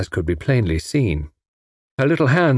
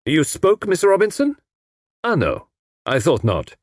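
higher-pitched menacing question, then dismissal; 7 semitones higher than usual. A narrator's reading. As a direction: challenge your assistant with a touch of menace. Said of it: "His tone was menacing".

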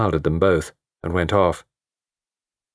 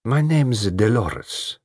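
slow sultry drawl; 3 semitones higher, much slower than usual. A narrator's reading.

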